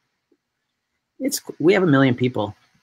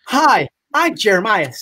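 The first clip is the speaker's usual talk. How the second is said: higher, slow salesy hello; cheesy networker greeting; 8 semitones higher, 4 dB louder, slower than usual. Retold or imitated. Mocking a pushy conference networker, cheesy higher greeting. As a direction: ham up a pushy card-handing hello. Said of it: "With a bunch of cards like this".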